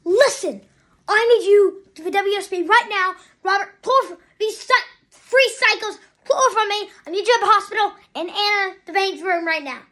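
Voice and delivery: loud, urgent retold demand; mock exclaiming. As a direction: act out a man demanding help, loudly. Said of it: "in an exclamation point, he's like".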